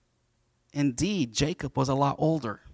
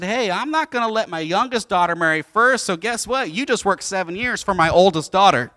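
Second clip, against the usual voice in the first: loud, higher mock bluster; forceful, raised-voice retelling; brash announcement; 5 semitones higher, 7 dB louder than usual. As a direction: act a trickster uncle laying down the law. Laban announcing his trick, acted.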